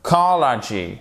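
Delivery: "Psychology" begun at COL, as "KAH-la-gee"; first syllable left off. Shown as a demonstration. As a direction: say only "-chology" of "Psychology". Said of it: "après, vous rajoutez".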